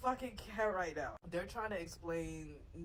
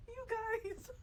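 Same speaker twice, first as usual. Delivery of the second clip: laughing till crying; 12 semitones higher than usual. Real, not acted.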